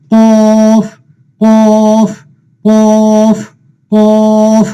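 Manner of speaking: কফ held long on one steady note, thick opening consonant; heavy drawn-out pronunciation. Shown as a demonstration. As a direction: chant each কফ slowly and heavily. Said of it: "উঁচু মোটা করে পড়তে হবে".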